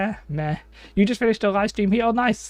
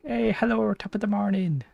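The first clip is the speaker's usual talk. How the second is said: higher-pitched go at an Irish accent; Irish accent attempt; 4 semitones higher than usual. Shown as a demonstration. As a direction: try on a playful Irish greeting. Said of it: "I'm trying to do Irish".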